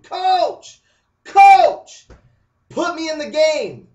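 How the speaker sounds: high, loud, pleading yell; desperate scream. Acted. Boy screams to his coach to play, desperate loud yell. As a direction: scream it from across the field, begging. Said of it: "here comes that boy screaming".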